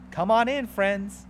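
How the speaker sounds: high, loud, happy invitation; beaming "come on in"; joyful welcome. A narrator's reading. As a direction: call them in with open arms. Jesus welcoming friends.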